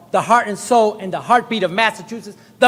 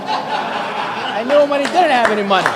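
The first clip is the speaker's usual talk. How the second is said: laughing. Real, not acted. Laughing while repeating a joke about the governor's past. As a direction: repeat it through a chuckle. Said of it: "You know what I mean?".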